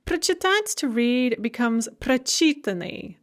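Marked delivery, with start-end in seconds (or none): 0.1-0.7 прочитать with the stress on the last syllable, -ать; stress on final syllable. Shown as a demonstration. Teacher showing where stress starts, stress on the -ать of прочитать. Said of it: "The stress shifts from at to e". 2.0-3.2 прочитаны stressed on the second syllable, чи; shifted stress. Shown as a demonstration. Teacher showing the stress shift, чи stressed in прочитаны. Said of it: "The stress shifts from at to e".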